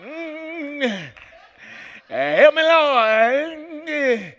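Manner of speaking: high, sung preacher hoop. Pretend. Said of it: "I should just hoop my name".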